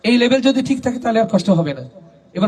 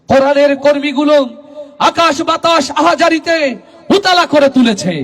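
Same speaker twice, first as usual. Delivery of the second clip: preaching at full force; 7 semitones higher than usual. Real, not acted. Preacher urging the crowd to give their life to the Quran's path, building to a shout. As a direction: climb phrase by phrase into a full-throated call.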